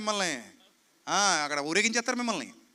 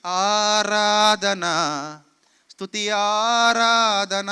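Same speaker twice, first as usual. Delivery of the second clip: mock worship; 5 dB louder, much slower than usual. Mocking careless worship singing.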